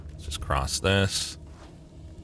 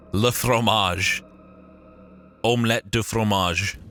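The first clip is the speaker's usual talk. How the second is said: mock French accent; 3 semitones higher than usual. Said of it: "Very original joke about French".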